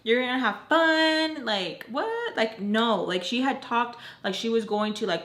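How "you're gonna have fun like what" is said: squeaky high incredulous What, then high teasing disbelief; mock shock. Imitating others who think it sounds fun, mock shocked. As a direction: imitate someone gasping at your complaint. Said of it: "I know to others, like".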